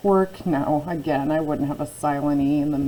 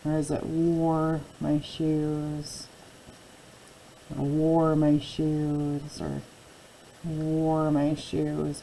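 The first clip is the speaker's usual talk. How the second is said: slow teaching voice; much slower than usual. Shown as a demonstration. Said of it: "let's try out our headers".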